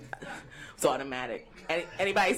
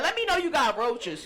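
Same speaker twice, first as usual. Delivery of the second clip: ordinary talk, nothing marked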